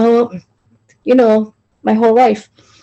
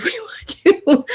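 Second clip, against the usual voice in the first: laughing; 9 semitones higher, 5 dB quieter than usual. Retold or imitated. Retelling the children's plea, laughing.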